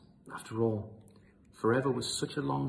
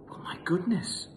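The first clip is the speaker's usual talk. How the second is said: amazed whisper; 7 semitones higher than usual. A narrator's reading. Narrator amazed.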